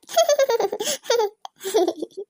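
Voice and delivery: long, high-pitched giggle; high giggling. Acted. Laughing over the video of uncle's fear, high giggles. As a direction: giggle away in bursts.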